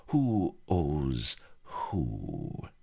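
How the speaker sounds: "who owes who" drawn out very slowly with a lisp. A narrator's reading. Bilbo savouring his revenge on Avery, slow lisp on "who owes who". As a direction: lisp "who owes who", stretching each word. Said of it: "he lisped".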